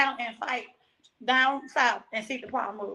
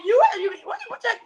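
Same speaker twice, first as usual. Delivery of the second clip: higher-pitched, agitated stammer; retold angry outburst, pitched up; mad husband; 8 semitones higher than usual. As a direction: imitate an angry husband tripping over words. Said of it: "My husband got mad".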